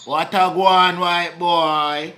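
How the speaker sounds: put-on Jamaican patois greeting; Jamaican accent. Greeting a passer-by in a Jamaican accent. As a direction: hail him in a Jamaican accent. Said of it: "You just did that accent".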